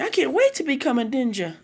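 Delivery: put-on high-pitched eager kids' voice; giddy, high and thrilled; excited. A narrator's reading. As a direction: squeal it with childlike eagerness. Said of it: "they all get so excited".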